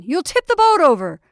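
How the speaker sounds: loud, high-pitched alarm; urgent warning. A narrator's reading. Warning man standing in boat.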